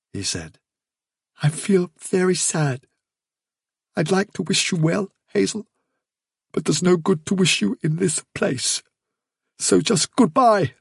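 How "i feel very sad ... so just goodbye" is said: sad Fiver. Acted. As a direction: take leave sadly in a higher voice. Fiver's sad farewell to Hazel.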